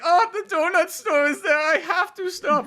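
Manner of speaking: very high-pitched mock-German voice; squeaky put-on German accent. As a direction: do a hammed-up German Watson, high and pleading. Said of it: "he's like a German voice".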